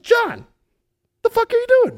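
high, incredulous mock yell; friends yelling. Friends in the van spotting John in the woods, high mock yell. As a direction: call out in disbelief from the van.

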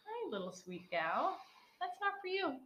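cooing to a pet. Real, not acted. Talking to the cat.

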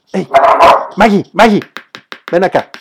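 loud call to the dog. Real, not acted. Vlogger calls his dog Maggie over mid-recording, loud call.

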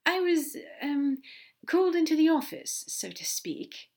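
high, halting, evasive answer; dithering. Acted. Aziraphale admitting the office summons to Crowley, dithering. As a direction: hedge haltingly, tailing down at the end. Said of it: "Aziraphale dithered a bit before answering".

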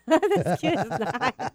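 laughing while mocking the word cute; laughing mockery. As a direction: repeat it through a laugh, mocking.

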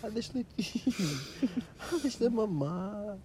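put-on baby talk. Cooing over the kitten.

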